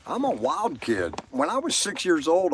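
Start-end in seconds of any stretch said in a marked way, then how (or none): none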